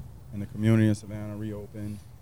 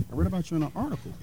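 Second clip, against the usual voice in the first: higher, fast customer voice; higher, quick imitation of a customer; imitating customer; 8 semitones higher, much faster than usual. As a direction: rattle it off fast in a higher put-on voice. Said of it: "the guy said".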